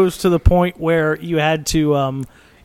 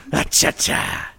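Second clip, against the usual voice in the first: put-on old comedian voice; 4 semitones lower, 5 dB quieter, slower than usual. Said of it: "Groucho Marx kinda comedians".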